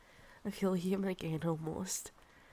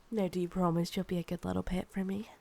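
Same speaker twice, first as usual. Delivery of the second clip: normal talking voice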